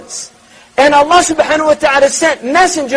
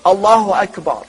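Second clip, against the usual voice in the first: mechanical prayer; 6 semitones lower, 3 dB quieter than usual. Retold or imitated. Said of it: "go into a mechanical mode".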